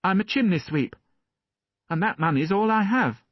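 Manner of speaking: put-on high, open little boy's voice; a child happily giving his name; trusting boy. A narrator's reading. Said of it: "said the boy, smiling trustfully".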